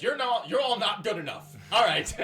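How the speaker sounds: a commander waving off the eager inmates, loud and dismissive; commander's dismissal. Acted. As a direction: bark it down at the inmates.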